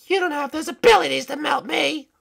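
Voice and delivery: high-pitched princess retort; high cartoon princess, unconvinced; sceptical princess. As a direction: dismiss the threat in a high voice. Princess dismisses the threat.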